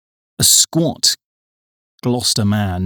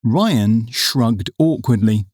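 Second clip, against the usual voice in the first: ordinary delivery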